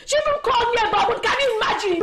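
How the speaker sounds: high, loud, rapid tattling; indignant complaint. Acted. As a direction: rush out the grievance in outrage. Complains to mother about insult.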